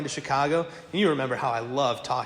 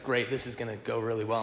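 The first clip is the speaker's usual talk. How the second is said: sarcastic inner groan; 5 semitones lower, 6 dB quieter than usual. Retold or imitated. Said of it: "I'm just like".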